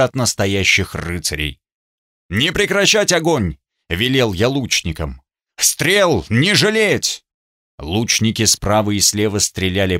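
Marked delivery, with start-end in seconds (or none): 2.3-3.6 high, commanding call; shouted order. A narrator's reading. 5.6-7.2 high, slow, commanding call; shouted slow order. A narrator's reading.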